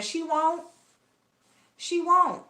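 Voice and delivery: high teasing She won't; sing-song taunt. Acted. Taunting the streamer about her numbers.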